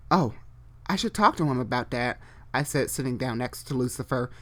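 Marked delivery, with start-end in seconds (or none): none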